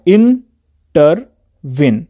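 इंटरवीन said very slowly, syllable by syllable, stress on वीन; slow syllable-split word. Shown as a demonstration. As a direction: spell out इंटरवीन in three slow beats, leaning on the last. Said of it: "जब आप बोलते हैं इन".

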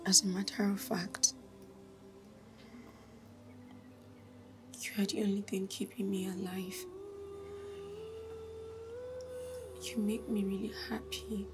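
hushed, secret whisper; soft whispered gratitude; whispered thanks. Acted. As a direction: keep it to a breath so the house can't hear. Secret whispered talk with lover.

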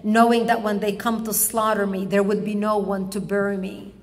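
usual speaking voice

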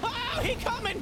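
high, loud panicked cry; alarmed shout. Real, not acted. Player sees the bear charging, alarmed shout.

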